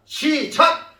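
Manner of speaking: high, loud go signal; loud cue. Real, not acted. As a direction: call the congregation in sharply. Cues congregation to shout together.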